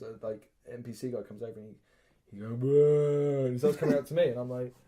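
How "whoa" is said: long drawn-out creature moan; imitated monster groan. Imitates monster approaching to eat him.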